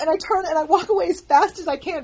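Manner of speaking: usual speaking voice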